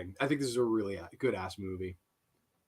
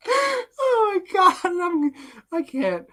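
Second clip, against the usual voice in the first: high, drawn-out words through real laughter; laughing fit; 13 semitones higher, much slower than usual. Real, not acted. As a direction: she can barely get it out for laughing. Breaks up over chat message.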